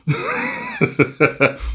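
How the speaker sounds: very high giggling laugh. Real, not acted. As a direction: crack up in a high cackle after a slip. Cracks up after slip while reading.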